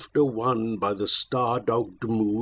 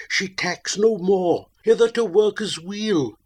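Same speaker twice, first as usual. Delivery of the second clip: higher, eager call; excited cry; 6 semitones higher, 4 dB louder than usual. Acted. Mariner telling shipmates the ship comes on, excited high call. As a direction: urge them to look. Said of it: "I cried".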